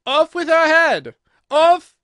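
high, very loud queen's shriek; full-force, drawn-out high command; furious scream. A narrator's reading. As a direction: scream the order in royal fury.

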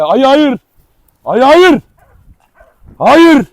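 high loud cry of no; alarmed shout at the dog. Real, not acted. Stopping dog from attacking.

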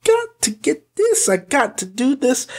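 high, desperate craving voice; frantic excitement. Retold or imitated. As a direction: squeal it like you can't wait. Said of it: "I was like".

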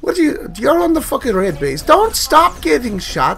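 loud, high exasperated shouting; frantic yelling. Real, not acted. Gamer yelling at his losing team, loud frantic frustration. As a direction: yell at the useless teammates.

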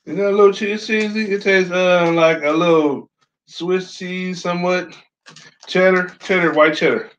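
crunchy snack in mouth; chewing chips while talking. Real, not acted. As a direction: mouth partly full of chips. Seen 0: Man holds chip piece near mouth, jaw working while speaking.

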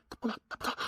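breathy guilty whisper; faint remorseful whisper; whispered admission. Acted. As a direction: admit it under your breath. Admitting fault, barely audible.

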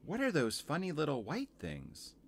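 put-on high, curious child voice; Lucy. Girl asking about small laundry, high and curious. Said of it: "said Lucy".

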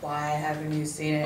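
mock robotic big brother. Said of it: "He doesn't speak like that".

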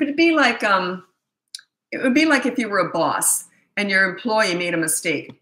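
ordinary talk, nothing marked

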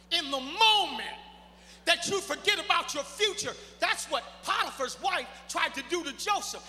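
loud, rising preacher's cry with each line punched; full-force preaching. Acted. Preacher pressing the congregation about dreams, at full force. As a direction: preach it out to the back pews.

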